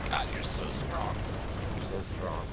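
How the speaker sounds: whispered, slowed, struggling; losing a melee fight; strained whisper. Real, not acted. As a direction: grunt it out under strain. Struggling with stronger enemy.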